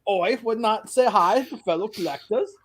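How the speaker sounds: hammed-up British accent, laughing a bit. Posing as an English collector on the channel, British accent. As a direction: play an English toy collector. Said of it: "I'm gonna try to do it in a British accent".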